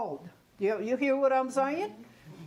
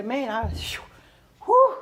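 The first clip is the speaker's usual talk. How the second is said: overwhelmed whew; 6 dB louder than usual. Retold or imitated. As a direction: blow it out loud, overwhelmed. Imagined acute grief outburst.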